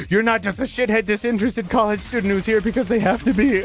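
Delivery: high-pitched mock elation; hammed-up amazed relief; retold joy. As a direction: imitate an overjoyed clerk gushing. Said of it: "sparkle of joy in the man's eyes".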